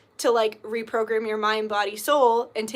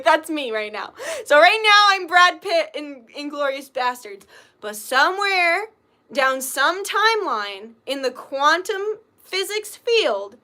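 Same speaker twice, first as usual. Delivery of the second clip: usual speaking voice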